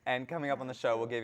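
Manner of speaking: high, loud greeting to the audience; show-opening announcer. Acted.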